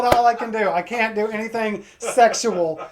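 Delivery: normal talking voice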